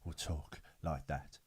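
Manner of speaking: put-on slow, raspy, very low old man; low, airy near-whisper, out of breath; as if running out of air and about to cry; Harry Brown. Shown as a demonstration. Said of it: "a lot more slower and a bit more raspy".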